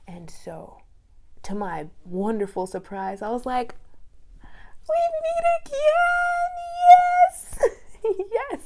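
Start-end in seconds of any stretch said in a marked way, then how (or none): 4.4-7.6 high, loud sung-out cheer; overjoyed singsong greeting; thrilled greeting. Retold or imitated. Thrilled to see him again. 7.6-8.3 high excited laugh; giddy laugh; delighted laugh. Real, not acted. Laugh after greeting.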